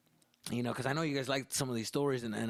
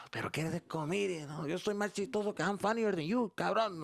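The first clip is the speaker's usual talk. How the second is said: higher mocking Mexican dad voice; 7 semitones higher than usual. Dad taunting his son about being funnier, high and mocking. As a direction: taunt the son's ambitions.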